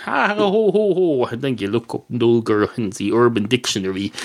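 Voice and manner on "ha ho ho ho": mock laugh. Mock laugh at own pun.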